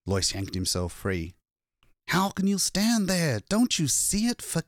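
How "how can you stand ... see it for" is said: high-pitched, desperate outburst; distraught, stretching out the last words; frantic plea. A narrator's reading. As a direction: plead frantically with people who won't look. Frantic man pleads with bystanders.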